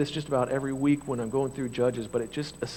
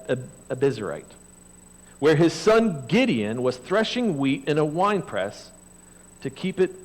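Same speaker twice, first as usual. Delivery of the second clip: sermon scripture reading; 5 semitones higher, 7 dB louder than usual. Acted.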